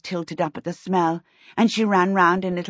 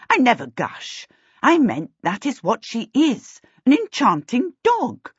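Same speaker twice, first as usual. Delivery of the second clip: emphatic, higher-pitched correction; testy witch setting things straight; impatient; 6 semitones higher, 3 dB louder than usual. A narrator's reading. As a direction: insist impatiently, punching key words. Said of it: "said impatiently".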